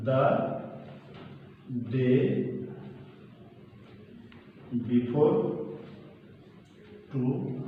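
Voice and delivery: word by word with long pauses; slow dictation. Shown as a demonstration. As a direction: spell out the phrase at writing speed. Dictating the answer while writing.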